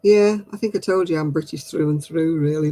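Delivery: ordinary delivery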